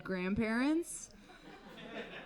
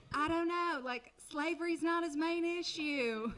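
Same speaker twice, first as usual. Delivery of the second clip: higher, breezy Southern wife justifying her husband; Georgia wife; 6 semitones higher than usual. Retold or imitated. Comic imagining a Civil War wife talking about her husband, breezy then exploding. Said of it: "a wife in Georgia who's like".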